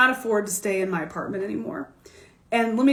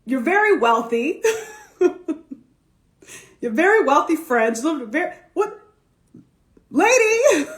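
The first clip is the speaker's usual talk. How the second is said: louder, higher pleading protest; incredulous protest; 4 semitones higher, 4 dB louder than usual. Retold or imitated. To a wealthy client, incredulous protest. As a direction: laugh off her advice in disbelief. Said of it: "I said".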